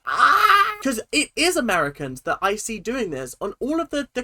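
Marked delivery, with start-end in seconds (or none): 0.1-0.5 loud, high "ah"; frustrated cry. Real, not acted. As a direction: let out a sharp frustrated cry. Frustrated outburst while reacting.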